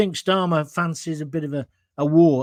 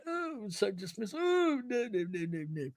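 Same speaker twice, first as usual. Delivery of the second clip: a hint of airy posh dismissal, much softer; mock-dismissive brush-off, breathy and quiet; lightly sending up a rich man's shrug; 3 semitones higher, 13 dB quieter than usual. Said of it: "He can afford to be kind of".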